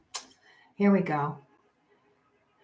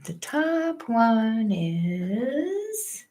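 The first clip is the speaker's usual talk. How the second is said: very slow, stretched words; slow sing-song stretch; drawn out; 4 semitones higher, much slower than usual. Real, not acted. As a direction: stretch it out as you point. Drawn out pointing.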